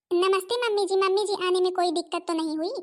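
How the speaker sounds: ordinary delivery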